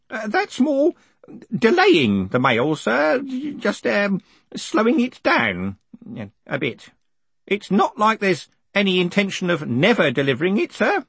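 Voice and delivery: high, hesitant excuse-making; old postal worker, worried. Acted. Grote excuses the undelivered mail, high and worried. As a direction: falter nervously in a high old-man voice.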